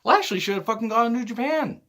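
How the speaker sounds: high-pitched indignant outburst; exasperated. Real, not acted. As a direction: protest with your pitch shooting up. Exasperated rant about wrestler's booking.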